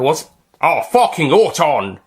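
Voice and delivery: a loud slow burst of narrated shock; shocked outburst. A narrator's reading. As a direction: spit out the swear in shock.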